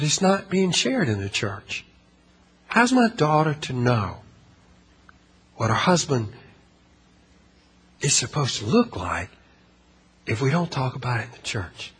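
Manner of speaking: very soft and low, phrase by phrase; retold hushed plea. Preacher voicing a mom's worry about church silence, hushed. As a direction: quote her quietly, pausing between phrases. Retells a mother's earnest plea.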